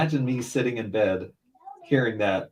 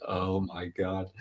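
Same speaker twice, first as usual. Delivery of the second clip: slow, softer shock; hushed dismay; 3 semitones lower, 7 dB quieter, slower than usual. Retold or imitated. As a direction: say it low-key and drawn out, appalled. Retelling his shocked reaction.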